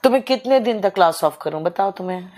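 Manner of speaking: usual speaking voice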